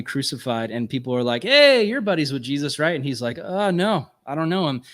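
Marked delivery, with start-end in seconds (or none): 1.4-2.9 higher, louder mock challenge; accusing bystander. Crowd confronting Peter about Jesus, mock accusing. 3.4-4.8 higher-pitched mock evasiveness; evasive denial. Peter denying Jesus to the crowd, mock evasive.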